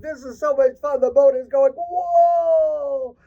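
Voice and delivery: high, thrilled crab's cry; a crab whooping with glee on a rocking boat; excited. Acted. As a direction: squeal with delight on the boat. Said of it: "He said".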